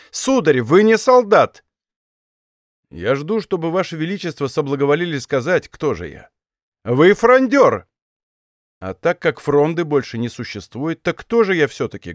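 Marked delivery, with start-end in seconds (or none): 0.1-1.7 slow, higher, louder rebuke; stern condemnation. A narrator's reading. King judges the captain's questioning loyalty, stern. 6.9-7.9 drawn-out, louder, higher denunciation; brand him slowly and forcefully; emphatic accusation. A narrator's reading. King tells the captain what he is, accusing.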